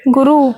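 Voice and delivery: गुरु said short and clipped; short u ending. Shown as a demonstration. Teacher giving the right गुरु for the class. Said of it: "छोटा ऊ होता है".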